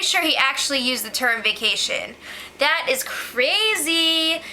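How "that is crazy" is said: very slow, stretched out and higher; drawn-out disbelief. Real, not acted. Vlogger to viewers, slow stretched disbelief. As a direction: savor each word in disbelief. Reacting to vacation remark.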